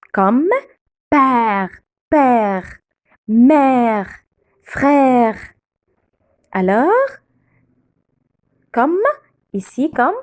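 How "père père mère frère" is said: long open "è" in père, mère, frère, one word at a time. Shown as a demonstration. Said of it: "On ouvre la bouche". (« è » ouvert et allongé dans père, mère, frère)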